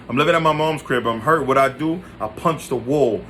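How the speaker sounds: plain reading voice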